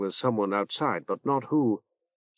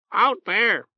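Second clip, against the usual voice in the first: high, squawky bird warning; a pet bird croaking an alert; talking bird; 9 semitones higher than usual. Acted. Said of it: "Orb warned them".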